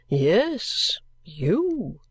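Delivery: slow, drawn-out character voice with swooping tune; gruff strange gentleman. Acted. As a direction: draw out each word like an eccentric gentleman.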